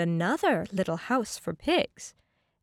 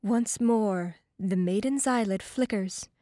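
plain reading voice

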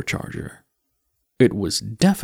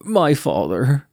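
put-on young Mort; 4 semitones higher, 3 dB louder, slower than usual. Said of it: "he said".

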